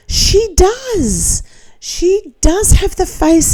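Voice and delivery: She does, jumping high; emphatic agreement. Real, not acted. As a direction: squeal it out in full agreement.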